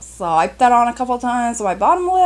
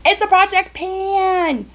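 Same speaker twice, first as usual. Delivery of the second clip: excited announcement; 10 semitones higher, slower than usual. Real, not acted. Announcing the video topic excitedly.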